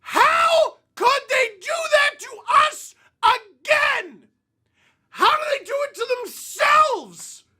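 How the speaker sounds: slow, high anguished shout; furious Leafs fan; raging yell. Real, not acted. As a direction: scream the question out. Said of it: "let's get out the rage".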